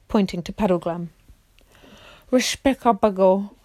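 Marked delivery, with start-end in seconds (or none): 2.3-3.5 slow, lightly voiced gloomy marsh-wiggle; Puddleglum's voice. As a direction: drag the word out like Puddleglum. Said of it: "said Puddleglum".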